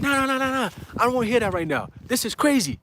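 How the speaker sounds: high, frantic refusal; bugging out. Retold or imitated. Mom told another job is coming, freaking out. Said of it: "She's bugging the fuck out".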